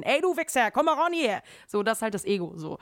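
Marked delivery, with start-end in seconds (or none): 0.0-1.4 higher and louder, picking a fight; ego shouting. Retold or imitated. The ego taunting someone to fight, loud challenge. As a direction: yell at the guy in the back. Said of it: "war so".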